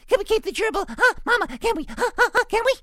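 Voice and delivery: very high, loud excited pleading; piglet in a tizzy. A narrator's reading. Baby pig begs Mama to keep the gerbil, squealing. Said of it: "Baby Sniff danced around in a tizzy".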